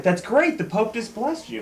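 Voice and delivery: high-pitched delighted exclamation; impressed enthusiasm. Acted. Joke teller voicing an impressed football player, high and thrilled. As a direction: voice a thrilled, awestruck friend.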